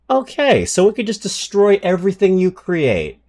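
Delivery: very high exasperated disbelief; can't believe how broken it is; incredulous. Real, not acted. Streamer reacting to an overpowered card in disbelief.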